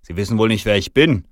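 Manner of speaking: loud, affronted Santa; indignant challenge. Acted. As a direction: challenge him loudly, affronted. Santa indignantly confronts the manager.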